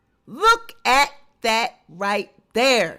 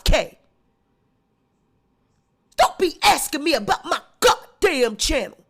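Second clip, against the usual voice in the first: loud, fast, indignant rant; mock furious Tasha; 4 dB louder, faster than usual. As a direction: bark it at the fans who ask too much. Voicing Tasha's angry rant.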